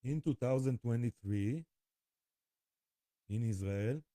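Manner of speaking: ordinary talk, nothing marked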